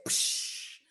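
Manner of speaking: short unvoiced burst mimicking a snap; mouth-made crack; crack sound effect. Shown as a demonstration. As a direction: spit out a breaking noise. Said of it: "break that enemy in half like this".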